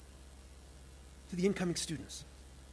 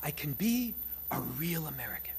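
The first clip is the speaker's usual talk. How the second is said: 1 whisper; 3 semitones lower, 6 dB quieter than usual. Retold or imitated. Inner voice, secret wish.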